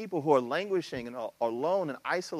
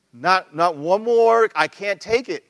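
exasperated parent; 3 semitones higher, 9 dB louder, slower than usual. Retold or imitated. Exhausted parent at home.

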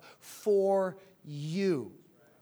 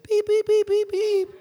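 high, louder mock beeps; loud beeping; 14 semitones higher, 7 dB louder than usual. Pastor voicing God's beeping to reluctant churchgoers, loud high beeps. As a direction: blare the beeps at the congregation. God voiced as beeping car.

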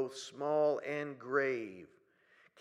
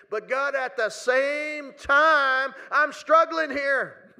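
loud prayer; 8 semitones higher, 11 dB louder, much slower than usual. Pretend. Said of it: "say that with me".

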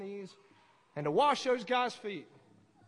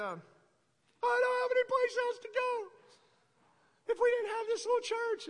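high-pitched mock crying voice; imitated tearful wail; 11 semitones higher, slower than usual. Preacher imitating a woman with nowhere else to go, tearful wail. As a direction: wail it slowly, far above your own pitch. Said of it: "she burst into tears".

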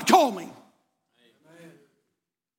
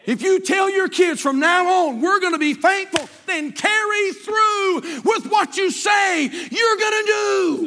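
slow shouted preaching; 10 semitones higher, much slower than usual. Acted. Pastor urging parents to follow through, slow shouted plea. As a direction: drive each word home at full force.

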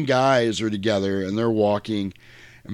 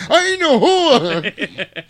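high, very slow, laughing; drawn-out high offended denial; offended retort; 13 semitones higher, 7 dB louder, much slower than usual. Retold or imitated. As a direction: stretch it out with a laugh. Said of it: "you hear her go".

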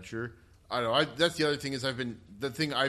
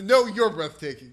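loud, high imitated comeback; raised-voice retold reply; playful retort; 8 semitones higher, 11 dB louder than usual. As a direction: throw the compliment back at the crowd, loudly. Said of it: "he laughs and points back and says".

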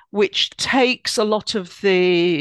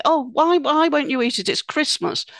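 insistent host; 6 semitones higher than usual. Retold or imitated. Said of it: "When you get into arguments".

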